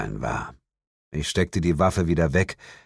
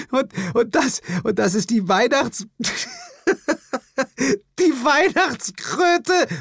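snorting with laughter. Acted. Jane sees the toad in his pocket, snorting laughter. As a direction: burst out laughing mid-word. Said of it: "prustete sie".

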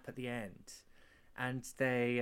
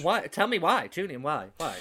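1 teasing demand; 2 semitones higher, 8 dB louder than usual. Acted. Teasing the host.

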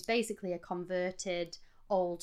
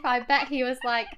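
ordinary delivery

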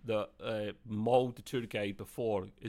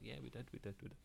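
repeated answer, much softer; a much quieter reassurance; much softer reply; 18 dB quieter, much faster than usual. Retold or imitated.

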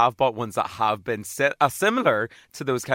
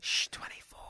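playful hushed whisper; 13 dB quieter than usual. Pretend. Co-host playing along with the secret joke, whispered. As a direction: hush it like a conspirator.

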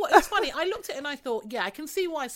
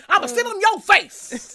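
high, loud, slow sassy growl; 11 semitones higher, 13 dB louder, slower than usual. Retold or imitated. Mocking [name] to [name], loud high sassy voice. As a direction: laugh through a diva's bold line. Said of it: "when she gets home".

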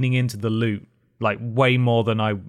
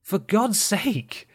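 exasperated rant; 7 semitones higher than usual. Real, not acted. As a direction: plead with the game in frustration.

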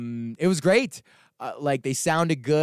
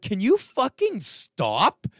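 fed-up demand; 8 semitones higher, much slower than usual. Retold or imitated. Telling the screaming woman to stop, slow exasperated. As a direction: drag it out, fed up. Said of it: "I was like".

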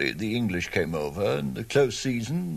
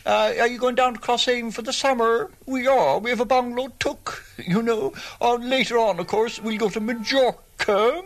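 put-on higher, sing-song middling Cork accent; middle-of-the-road Cork accent; 13 semitones higher, 3 dB louder than usual. Two Cork neighbours chatting about summer plans, middle-class Cork accent. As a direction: chat like a Cork suburbanite off to the seaside. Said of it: "there's the middle-of-the-road accent".

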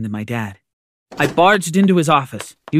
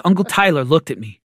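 ordinary delivery

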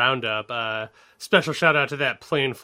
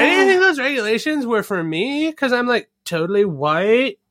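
mock entitled influencer; 8 semitones higher, 4 dB louder than usual. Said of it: "It's just like".

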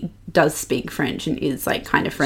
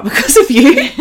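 higher, louder, amused teasing; raised pitch breaking into laughter; laughing; 9 semitones higher, 8 dB louder than usual. Real, not acted. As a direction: laugh through the words. Teasing co-host about picked-up habit.

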